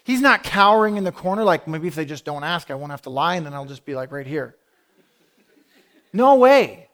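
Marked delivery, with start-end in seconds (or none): 1.7-4.6 soft, low mumbled thinking-aloud; small, quiet voice of someone lying low; mock cowering. Imagined cowering man hiding in corner. 6.1-6.9 loud, high exclamation; punchy, raised-voice denial; emphatic dismissal. Real, not acted. Emphatic rejection of the cowering idea.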